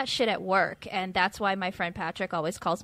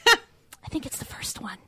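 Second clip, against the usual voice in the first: whisper; 3 semitones higher than usual. Retold or imitated. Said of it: "the girl's like".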